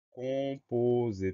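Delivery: stretched-out word, syllables held long; slow dictation. Shown as a demonstration. Teacher gives pupils the next word to spell, slowly drawn out.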